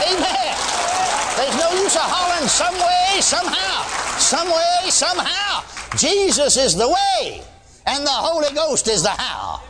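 high, slow, shouted proclamation; full-force preaching. Acted. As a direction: thunder it out to the congregation.

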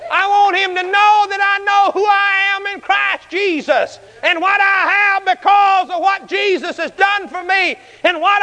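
high, sustained, emphatic holler; reared-back bold declaration. Retold or imitated. Bold preacher wanting the devil to hear him, reared-back holler. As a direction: rear back and declare it with drawn-out force. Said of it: "he just reared back and said".

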